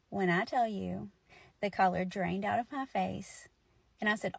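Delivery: usual speaking voice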